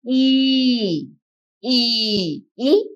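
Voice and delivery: E held as a long "ee" for repetition; long E sound. Shown as a demonstration. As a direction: say E as "ee", for pupils to echo. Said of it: "repeat with me".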